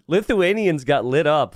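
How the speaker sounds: laughing through the words, slowed down; amused at the draft board, laughing as you say it. Real, not acted.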